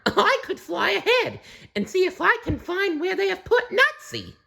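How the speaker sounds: very high cackly bird voice; laughing kookaburra voice. A narrator's reading. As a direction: speak through a bird's laugh. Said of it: "offered Jacko".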